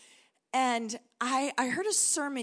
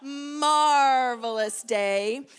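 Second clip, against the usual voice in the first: MARVELOUS drawn out very slowly, sung-up and louder; with attitude; 4 dB louder, much slower than usual. Shown as a demonstration. Showing the church how to say marvelous with attitude, drawn out. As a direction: stretch "Marvelous" long and give it sass. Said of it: "you have to give it some attitude".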